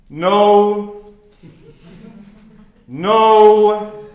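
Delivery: high, loud imitated professor refusal; mock stern no. The professor shooting down students' cases, loud mock refusal. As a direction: bark it out like the professor rejecting answers.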